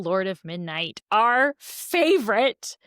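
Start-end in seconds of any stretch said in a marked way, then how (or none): 1.1-2.8 slow, drawn-out reveal; dramatic announcement. Acted. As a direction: savor each word slowly.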